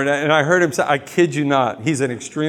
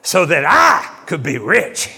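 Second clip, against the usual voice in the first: TV preacher impression; 3 semitones higher, 4 dB louder than usual. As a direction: ham up a prosperity preacher's boast. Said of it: "You ever hear those T V preachers?".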